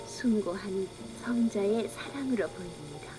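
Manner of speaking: plain reading voice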